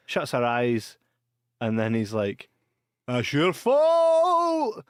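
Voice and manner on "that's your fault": high, very slow accusing voice; accusing sheriff. Retold or imitated. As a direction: drag out the blame. Sheriff blaming doctor, voiced.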